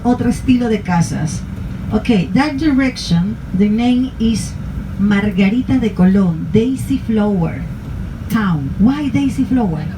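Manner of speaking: ordinary talk, nothing marked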